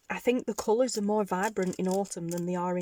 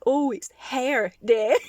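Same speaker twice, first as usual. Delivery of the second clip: teasing daughter; 5 semitones higher, 8 dB louder, slower than usual. Retold or imitated. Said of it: "she's like".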